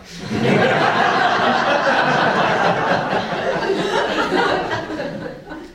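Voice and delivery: a long, airy, high giggle; breathy laugh. A narrator's reading. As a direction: giggle at the cheated crocodile. Narrator laughs at crocodile.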